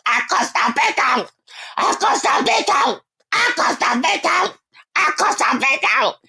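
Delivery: high, hoarse shouted boast in mimicry; croaky, high-pitched mock bragging; dry-throated mocking. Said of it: "listen to this dry throat, ashy throat bitch".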